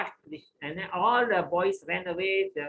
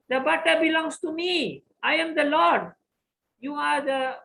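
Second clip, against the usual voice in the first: proud boast; 11 semitones higher, 5 dB louder than usual. Acted. Krishna proclaims he is Lord.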